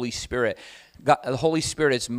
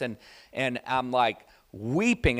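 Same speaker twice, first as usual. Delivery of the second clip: ordinary delivery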